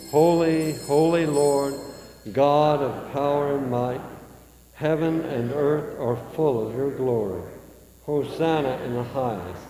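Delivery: ordinary delivery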